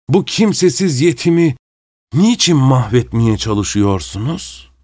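hammed-up plaintive whine; mock self-pity. A narrator's reading. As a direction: play the poor helpless orphan. Masked man feigns being helpless victim.

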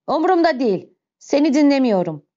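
impatient, contemptuous outburst; angry refusal. Acted. Javert angrily refusing a plea.

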